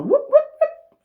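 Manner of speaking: high, loud whoop of a police siren; whooping siren noise; siren imitation. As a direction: mimic the cop siren.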